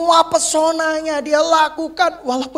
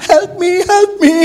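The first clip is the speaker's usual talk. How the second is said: hammed-up call for help, higher and louder; mock cry for help; 6 semitones higher, 6 dB louder than usual. Wife mocked by preacher, calling help me in mock distress. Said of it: "kok teriak-teriak".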